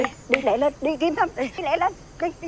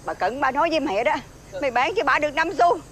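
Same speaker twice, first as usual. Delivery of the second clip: ordinary delivery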